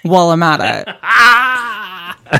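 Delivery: hammed-up fake crying, dragging the end out; teasing a self-pitying boyfriend with fake sobs; mock sobbing. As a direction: whine it like a pitiful martyr. Mocking his sad-sack text.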